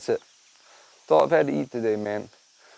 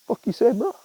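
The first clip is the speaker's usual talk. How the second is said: higher voice, a bristling bird picking a fight; tough-guy rooster; 9 semitones higher than usual. Pretend. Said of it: "he's just like".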